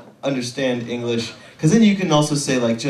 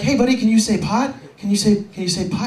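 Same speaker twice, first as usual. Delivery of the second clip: put-on talking to a toddler; 9 semitones higher than usual. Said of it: "I'm like".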